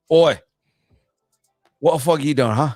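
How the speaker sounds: rough-and-ready Cockney challenge; Cockney Rocket. Shown as a demonstration. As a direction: demand it in a Cockney accent. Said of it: "give us what Rocket would sound like".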